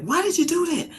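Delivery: high frantic scolding cry. Retold or imitated. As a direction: replay the panicked mother, pitch leaping up. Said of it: "but I'm frantic".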